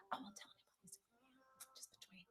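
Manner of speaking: hushed secret whisper, fading back to soft voice; conspiratorial hush to a friend. Pretend. Secret promise kept just between us.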